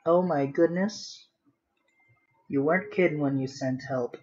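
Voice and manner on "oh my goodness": ordinary talk, nothing marked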